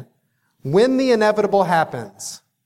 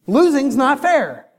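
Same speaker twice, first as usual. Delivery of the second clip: put-on higher, plaintive child voice; whiny kid; 8 semitones higher than usual. Daughter complaining to dad that losing isn't fair, higher and whiny. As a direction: whine it like a sulking little girl.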